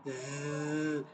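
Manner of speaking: soft sustained buzzing z; letter sound demonstration. Shown as a demonstration. Teacher isolating the sound of za, soft buzz. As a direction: buzz the z quietly by itself. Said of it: "like B sound".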